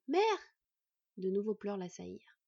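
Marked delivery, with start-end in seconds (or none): 0.1-0.2 louder, more insistent rising call. A narrator's reading. Boy calling again for vanished mother, urgent call. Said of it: "appela-t-il".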